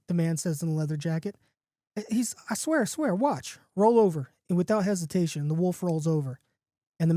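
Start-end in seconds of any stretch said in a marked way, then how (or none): 2.1-4.3 higher, eager voice, then a firm command; insistent dog owner. Acted. John proving the wolf's tricks to his friend, insistent. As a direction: swear it's true, then order the dog.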